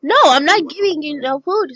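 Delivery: loud refusal, called back; yelling across the room. Acted. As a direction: shout back to your sister. Sisters arguing across the house.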